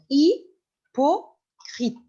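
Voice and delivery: hypocrite said slowly with no h sound; slow model: hypocrite, the H silent; teacher shows hypocrite without the H. Shown as a demonstration. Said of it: "on ne prononce pas le H".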